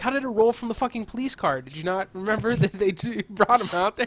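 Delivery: high-pitched incredulous rebuttal, breaking into a laugh. Real, not acted. Friend scoffing at a theory about the Joker's body, incredulous.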